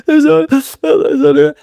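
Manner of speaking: high, slow, broken sobbing words; mock sobbing. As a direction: imitate losing it mid-sentence, sobbing. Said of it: "I'm, like, just losing it".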